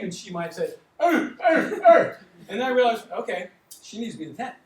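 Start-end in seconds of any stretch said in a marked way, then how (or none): 1.0-2.2 mock patient groan. Imitating a distressed, confused runner.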